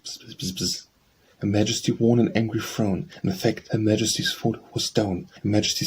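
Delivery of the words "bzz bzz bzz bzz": breathy whispered buzzing like a bee; soft bee buzz. Acted. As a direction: hum it quietly like a bee. Bee refrain in a poem.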